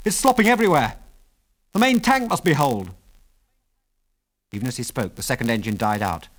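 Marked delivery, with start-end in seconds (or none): 0.1-2.9 high-pitched alarm; alarmed cry. A narrator's reading. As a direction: report the emergency in a high, urgent voice. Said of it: "he cried".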